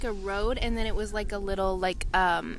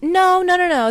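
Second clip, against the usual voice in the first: emphatic no; 9 semitones higher, 7 dB louder than usual. Retold or imitated. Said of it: "She said".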